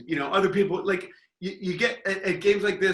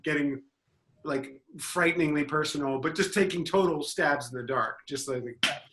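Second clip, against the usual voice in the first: ordinary delivery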